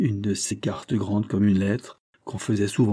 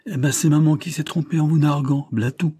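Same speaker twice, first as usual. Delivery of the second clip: indifferent thug tone; 6 semitones higher, 4 dB louder than usual. Acted. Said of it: "avec un ton indifférent de voyou".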